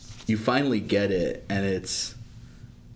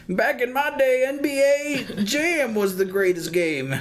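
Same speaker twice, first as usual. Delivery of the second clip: put-on old prospector; 4 dB louder than usual. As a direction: reminisce like a crusty old geezer. Mocking old gamer.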